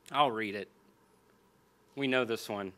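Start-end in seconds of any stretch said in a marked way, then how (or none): none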